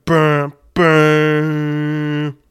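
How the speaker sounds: loud, high mock gunshot bams; imitated gunshots. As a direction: spit out two punchy bang sounds. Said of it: "all you hear from the background again is another bam".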